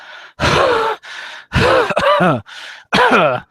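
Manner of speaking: loud, strained coughs and gasps; winded coughing. Acted. Player acts hero on all fours after a fall, winded coughing. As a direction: cough and heave after a huge hit.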